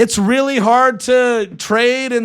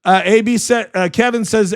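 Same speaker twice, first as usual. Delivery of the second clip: ordinary delivery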